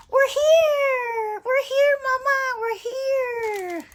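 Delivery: high, loud, drawn-out shout; little boy. Acted. Boy arriving with his mama, excited shout. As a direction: squeal it out like an excited child. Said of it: "the little boy says".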